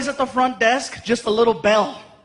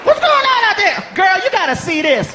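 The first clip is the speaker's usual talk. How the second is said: sassy clerk calling out; 4 semitones higher than usual. Acted. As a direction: holler to the coworker in back. Clerk calling coworker again.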